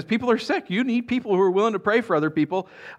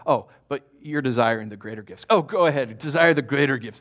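mock-approving, needling sarcasm; sarcastic; 2 semitones lower than usual. Shown as a demonstration. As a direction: egg them on sarcastically. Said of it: "I think he's being sarcastic".

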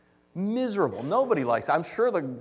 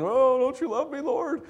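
high, whiny moan to God; hammed-up pitiful wail; mock bellyaching moan; 8 semitones higher than usual. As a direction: bellyache in a put-on, self-pitying voice. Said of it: "who would just bellyache and moan".

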